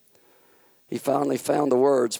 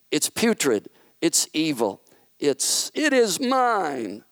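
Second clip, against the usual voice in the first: lightly voiced indignant devil, higher; a hint of a scornful fallen angel pleading his claim; Satan's protest; 5 semitones higher than usual. Satan protesting that earth is his, lightly voiced. Heated dispute with God.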